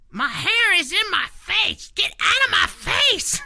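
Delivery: put-on high, slow shrieking toddler yell; toddler shouting. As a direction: yell at your own bangs like a two-year-old. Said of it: "she says".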